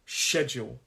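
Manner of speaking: SCHEDULE stressed on "SHED"; first-syllable stress. Shown as a demonstration. Teacher shows word stress, SCHEDULE stressed on first syllable. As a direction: hit "SHED", let "-ule" fall away. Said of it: "the stress of this word is on that first syllable".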